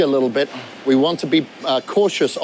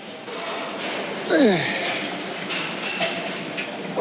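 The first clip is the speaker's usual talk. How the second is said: strained exhale; 6 semitones higher, 6 dB quieter than usual. Real, not acted. Straining through a heavy squat rep.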